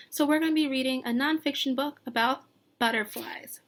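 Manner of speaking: ordinary talk, nothing marked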